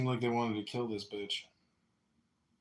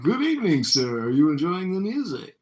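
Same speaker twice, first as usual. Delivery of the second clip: high, loud, overdone courtesy; polite greeting; 7 semitones higher, 8 dB louder than usual. Retold or imitated. Being polite to a man at the bar, mock-polite. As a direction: imitate yourself greeting a stranger with exaggerated politeness. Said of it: "I was being polite to".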